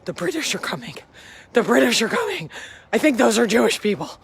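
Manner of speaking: hammed-up neighbourhood panic, building; imitated alarmed neighbours.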